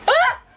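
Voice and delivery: short, high, loud squeal of delight; delighted shriek. Real, not acted. Pulling out the bath bomb, shrieking with delight. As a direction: let out a burst of joy.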